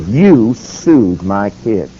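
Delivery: put-on "You sued my kids" very slow and low, each word weighted; emphatic accusation. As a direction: drag out "You sued my kids" with pauses between words. Acted accusation, climax of three.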